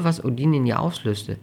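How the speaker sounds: usual speaking voice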